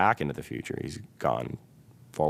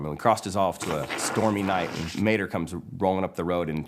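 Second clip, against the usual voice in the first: ordinary delivery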